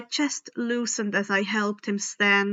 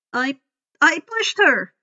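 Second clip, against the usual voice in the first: put-on nervous boy; 4 semitones higher, 6 dB louder than usual. A narrator's reading. Grover lies to help.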